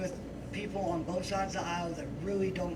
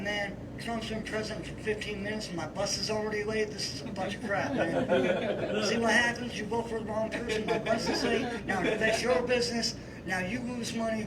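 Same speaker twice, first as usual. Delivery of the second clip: raised, louder teasing rant; mock complaint; 4 semitones higher, 4 dB louder than usual. Worker ribbing his Trump-supporting boss over a late bus, mock complaint. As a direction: needle him with a hammed-up gripe.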